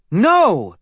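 shout. A narrator's reading. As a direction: snap back all together. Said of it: "his family shouted".

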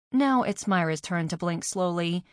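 ordinary delivery

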